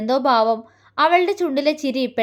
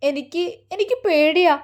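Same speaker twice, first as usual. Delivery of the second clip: higher, halting voice on the edge of a sob; a frightened bride confessing her fear, tearful; tearful and afraid; 4 semitones higher than usual. Acted. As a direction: stumble over the words, close to crying. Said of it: "അവളിൽ നിന്നൊരു തേങ്ങലുയർന്നു".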